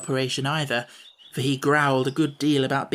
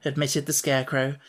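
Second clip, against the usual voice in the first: ordinary delivery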